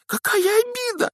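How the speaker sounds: very high breathless squeak; gasping elf. A narrator's reading. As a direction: gasp it out astonished. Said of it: "задохнулся эльф".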